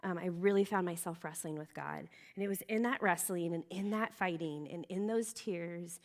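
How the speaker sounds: ordinary delivery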